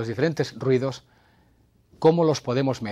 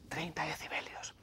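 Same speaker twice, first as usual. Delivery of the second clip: unvoiced, much softer whisper; 4 semitones higher, 16 dB quieter than usual. Shown as a demonstration. Host whispering thirty decibels on air. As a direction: breathe it like a secret. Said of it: "la voz de los secretos".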